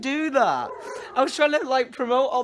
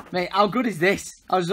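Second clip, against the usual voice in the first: usual speaking voice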